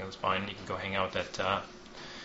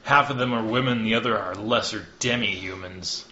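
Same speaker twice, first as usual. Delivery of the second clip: sneering envoy; 2 semitones higher, 6 dB louder than usual. Acted. Hawthorne mocking the leaders, loud scornful sneer. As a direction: spit out the insult with contempt.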